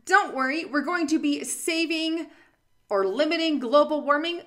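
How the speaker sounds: sarcastic. Real, not acted. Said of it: "that was very sarcastic".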